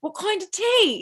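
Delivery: put-on high, loud caricature of a British accent. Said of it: "there's a lot of British accents".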